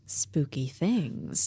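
hushed, slow spooky whisper-ish; mock mysterious. Shown as a demonstration. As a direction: drag it out like a ghost story. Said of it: "half of a spooky voice".

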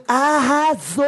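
high, exaggerated fake cheer; mock praise. Imitating a commenter praising a photo, mock gushing. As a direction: cheer it with obvious sarcasm. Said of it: "eu acho um deboche enorme".

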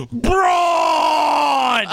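high, long-held name bellow; mock scream. Correcting his co-host on the entrance music, mock scream. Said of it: "That's what he says".

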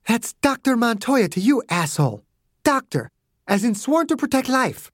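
high, indignant rebuke; a hint of scorn. A narrator's reading. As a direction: put him in his place. Margaret rebukes Clarence.

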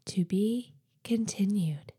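soft unvoiced whisper. Real, not acted. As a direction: breathe the sign-off softly. Whispered sign-off.

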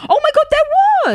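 high, loud shriek; excited shriek. Real, not acted. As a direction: squeal in realisation. Sudden realisation.

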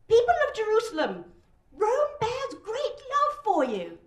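high-pitched pompous public address; weedy, thin shout. A narrator's reading. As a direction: declaim it thinly to a hostile crowd. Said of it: "his delivery is weedy and thin".

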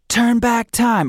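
high slow spooky title; ghostly voice. Pretend. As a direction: drag it out like a ghost. Quiz option voiced.

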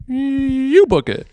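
high, slow, stretched-out YOU; drawn-out reply. Retold or imitated. Husband handing the booking back, high drawn-out mock reply.

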